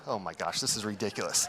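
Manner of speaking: scoffing quietly beside his weepy wife; muttered aside. Retold or imitated. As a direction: mutter it under your breath. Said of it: "And I'm like".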